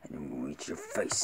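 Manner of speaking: low, soft monster whisper; Venom. Shown as a demonstration. Man echoing the film's threat, whispered monster impression. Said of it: "I got his voice down".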